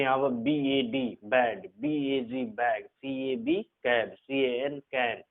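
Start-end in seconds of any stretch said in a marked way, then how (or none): none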